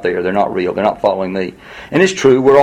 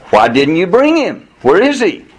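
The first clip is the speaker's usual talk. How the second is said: indignant demand; 7 semitones higher, 3 dB louder than usual. Retold or imitated. Chief priests question their officers, indignant and high. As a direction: press the officers for an answer.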